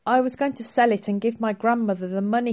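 plain reading voice

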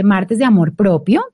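ordinary delivery

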